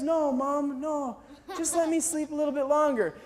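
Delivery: lightly voiced half-asleep friend; a hint of a drowsy boy; sleepy mumbling imitation. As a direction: softly plead to sleep on, as if half awake. Sleepy friend mistakes him for mom.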